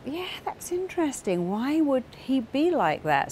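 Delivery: high-pitched, intrigued wondering; curious musing. Retold or imitated. Therapist puzzling over a client's story, curious musing. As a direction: wonder aloud, pitched well up.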